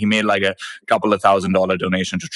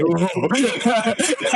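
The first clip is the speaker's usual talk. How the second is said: laughing; 10 semitones higher than usual. Real, not acted. Laughing mid mock quote.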